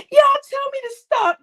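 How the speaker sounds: high, giggling plea; laughing too hard to go on; laughing fit. Real, not acted. Helpless laughter telling story.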